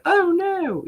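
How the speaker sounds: high squealed oh no; dismayed shriek. Retold or imitated. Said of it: "and I was like".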